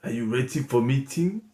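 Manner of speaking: very low quiet Grandmaster sensei; Grandmaster Ruhi Kazul. Acted. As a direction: speak low and hushed like a wise old master. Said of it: "I want to introduce the Grandmaster Sensei".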